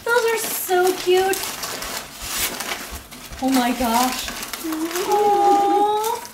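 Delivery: slow, high cooing; melting over a cute gift; overwhelmed by cuteness. Real, not acted.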